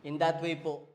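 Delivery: loud, fervent sermon voice; preaching at full force. Acted. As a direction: proclaim it to the whole church. Preacher raises voice to congregation.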